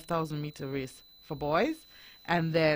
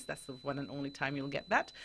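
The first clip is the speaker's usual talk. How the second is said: ordinary talk, nothing marked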